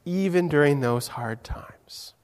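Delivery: hushed whisper. Real, not acted. Preacher reassuring the children, hushed whisper. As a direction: whisper it like a secret comfort. Stressing God's closeness to children.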